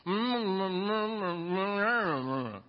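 closed-mouth hums, lips sealed around something; mumbling with mouth full. Retold or imitated. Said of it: "And the boy goes".

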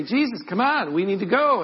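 high, loud, impatient urging; urgent pleading. Acted. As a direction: press him to get moving. Disciples urge Jesus to leave.

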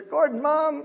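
loud, high-pitched call; imitating neighbours calling out a greeting; Chinatown greeting. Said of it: "that's how everyone in Chinatown greets her".

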